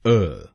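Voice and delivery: अ as a short neutral "uh", not "o"; syllable demo. Shown as a demonstration. Teacher shows the second syllable as अ. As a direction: say अ as a tiny unstressed "uh". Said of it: "दूसरे शब्दांश को यानी ओ हम बोलेंगे अ".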